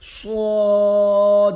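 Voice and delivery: full-mouthed long صاد; heavy long Suad. Shown as a demonstration. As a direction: open wide and drag out صاد. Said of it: "صاد کو منہ کھول کے پڑھنا ہے".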